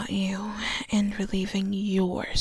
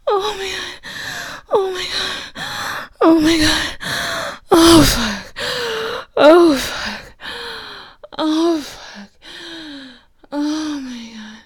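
fading moans; 5 semitones higher, 5 dB louder, slower than usual. Acted. After the climax, fading.

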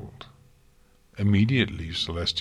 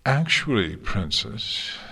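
ordinary delivery

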